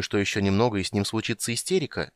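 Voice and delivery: ordinary delivery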